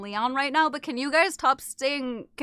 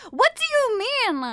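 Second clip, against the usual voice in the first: mimicking chat voice; 11 semitones higher, 6 dB louder, slower than usual. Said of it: "I understand why people say you and sounds like".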